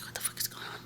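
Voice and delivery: hushed, breathless what-the-fuck whisper; whispered shock. Retold or imitated. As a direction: whisper it in retold disbelief. Retold shock, dog behind her.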